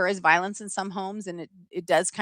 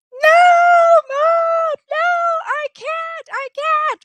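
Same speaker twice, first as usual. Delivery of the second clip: very high, hammed-up wail; child freaking out; 6 dB louder, slower than usual. Three-year-old begging Mom not to go back, panicked. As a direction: wail in mock panic like a small kid. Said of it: "I'm freaking out".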